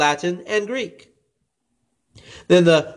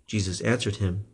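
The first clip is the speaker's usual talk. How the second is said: plain reading voice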